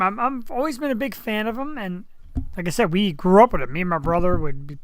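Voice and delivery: normal talking voice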